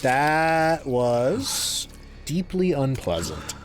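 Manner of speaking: slow, exhausted drawl; drained and slow. Real, not acted. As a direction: let the relief sink in. Drained after the fight.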